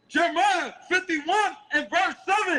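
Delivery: high, slow, ringing scripture reading; street-preaching Bible reader; scripture declamation. Acted.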